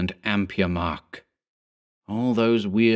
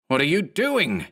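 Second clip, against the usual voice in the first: high, agitated yell without much volume; narrated character yelling, pitched up; 8 semitones higher than usual. A narrator's reading. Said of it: "Mark yelled".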